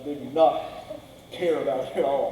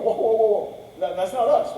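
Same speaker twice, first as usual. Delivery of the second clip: higher and louder, rushing to deny; panicked denial; alarmed protest; 7 semitones higher, 5 dB louder than usual. Retold or imitated. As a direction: throw your hands up, startled, insisting it isn't you. Brothers protest they're innocent.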